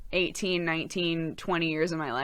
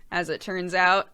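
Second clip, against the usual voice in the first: ordinary delivery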